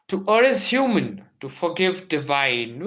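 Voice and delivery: ringing quotation reading; dramatic recitation. Shown as a demonstration. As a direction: announce it grandly. Declaiming quote opening.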